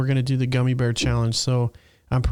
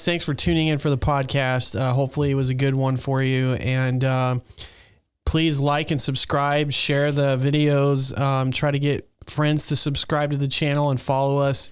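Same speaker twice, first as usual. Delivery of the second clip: normal talking voice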